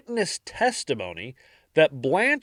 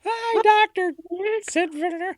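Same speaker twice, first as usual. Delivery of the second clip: high squeaky puppet greeting; Muppet voice. Pretend. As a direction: greet him like a cartoon. Cartoon greeting to doctor.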